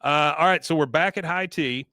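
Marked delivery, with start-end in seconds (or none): none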